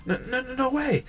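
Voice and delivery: high, fast panicky denial; panicked denial. Acted. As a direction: refuse to believe it, rushing. Girl realizes she was scammed.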